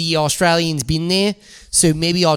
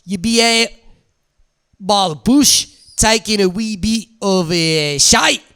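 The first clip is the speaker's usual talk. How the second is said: mock Aussie bush storyteller; broad Australian yarn with pauses; Australian accent. [name] tells of squatting by the bush in a put-on Australian accent. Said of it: "You're good at accents".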